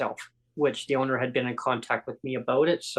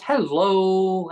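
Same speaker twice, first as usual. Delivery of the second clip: cheery greeting; 5 semitones higher, 5 dB louder than usual. Retold or imitated. Manager's retold greeting.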